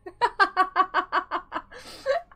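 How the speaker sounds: high rapid giggle; laugh. Real, not acted. Streamer amused by promoting a friend, high giggle. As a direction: burst of high, helpless giggling.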